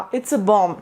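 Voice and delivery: low-pitched correction, no B in "bomb"; silent final B. Shown as a demonstration. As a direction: end "bomb" on M. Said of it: "इसका कोई sound नहीं है".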